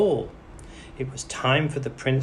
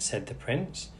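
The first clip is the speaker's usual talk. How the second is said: plain reading voice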